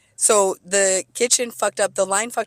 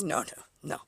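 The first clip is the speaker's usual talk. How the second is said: sheepish brush-off; 3 semitones lower, 9 dB quieter than usual. Retold or imitated. Manager backing down.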